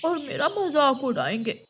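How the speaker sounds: lightly voiced child declining; hint of a worried child; reluctant young son. Son telling mother he'll be mocked, reluctant.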